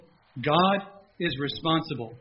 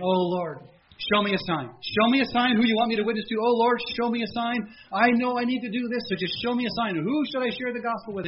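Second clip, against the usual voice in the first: higher, louder begging for a sign; earnest pleading prayer; 7 semitones higher, 4 dB louder than usual. Retold or imitated. Convicted man begging God for a sign, higher and pleading. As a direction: plead like a nervous believer.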